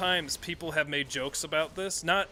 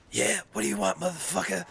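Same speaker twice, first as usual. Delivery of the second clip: raspy, higher put-on phone voice; 4 semitones higher, faster than usual. Mimicking a trumpeter picking up the phone, raspy. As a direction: imitate a rough-throated musician answering testily. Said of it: "like, a really raspy".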